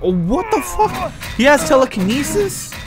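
shocked. Real, not acted. Enemy suddenly lifts her.

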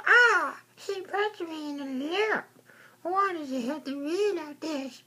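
very high squeaky yelp then whining; squeaky voice wailing after a punch; weird high voice. Pretend. Squeaky alter ego complaining of a punch to the lip, weird high voice.

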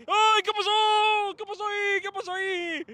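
high wail, loud then tapering; dismayed outcry. Real, not acted. Fan protesting what just happened on the pitch, dismayed outcry. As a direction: cry out high, drawing out each question.

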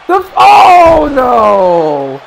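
long, slow, falling wail of dismay; loud dismayed cry. Real, not acted. Commentator seeing disaster strike Sonic, loud drawn-out dismay. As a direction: stretch it out loudly, sliding down.

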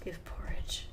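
whispered, scheming command; sneaky whisper. Pretend. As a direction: breathe it like a plotting poisoner. Sneaky command to feed wizard.

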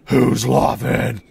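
hammed-up, slow bellow; loud second take. Corrected reply to the hooded stranger, hammed-up bellow. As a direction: bellow the question, drawn out and very loud. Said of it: "No, sorry, uh".

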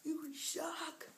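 high, drawn-out mock yell; hammed-up high-pitched outrage; mock rant. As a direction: whine an exaggerated rant at a friend. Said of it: "I went in a rant on him".

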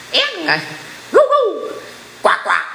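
high squeal and hoot, then quacks; mock animal calls in short bursts; imitated animal noises. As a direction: mimic a noisy farmyard crowd. Imitates farm animals disturbing listeners.

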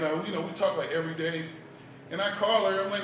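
ordinary talk, nothing marked

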